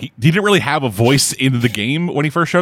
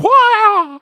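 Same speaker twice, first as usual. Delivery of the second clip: high, loud fighting yell; battle cry; 5 dB louder than usual. Pretend. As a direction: shriek it like a kung fu kick.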